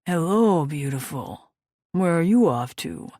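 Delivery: loud, charming hello; flirty biker. Acted. Biker flirting with passing woman, charming. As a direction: flirt with a smile in it.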